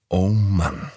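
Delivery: ordinary delivery, nothing marked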